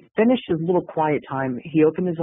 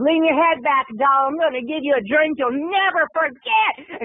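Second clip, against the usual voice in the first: higher, teasing come-on voice; sultry dancer voice; 8 semitones higher than usual. Retold or imitated. Dancer coaxes Kenny to take the shot, higher and teasing. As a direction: purr it at him like a flirty dancer.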